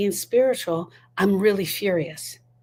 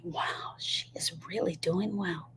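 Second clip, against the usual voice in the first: hushed, whispered aside about someone; admiring whisper; 2 semitones lower, 12 dB quieter than usual. Retold or imitated. Onlookers gossiping about a grieving widow, in an admiring whisper.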